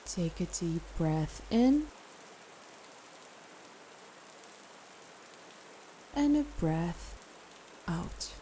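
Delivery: calm meditation guide with long pauses; guided meditation. Acted. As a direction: ease the listener into rest, phrase by phrase.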